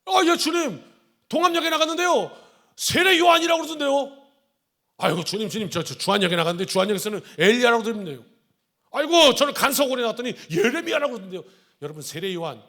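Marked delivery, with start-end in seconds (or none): 0.1-4.3 much higher, louder breathless reporting; rushing to pass on the news; excited disciple tattling; eager gossip. Retold or imitated. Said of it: "남의 말 전하기 바빠가지고". 5.0-11.4 a touch higher, quick busy telling; mild excited chatter; eager gossip. Retold or imitated. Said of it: "남의 말 전하기 바빠가지고".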